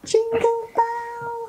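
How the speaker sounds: slow, high, drawn-out announcement. Real, not acted. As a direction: stretch the name out with delight.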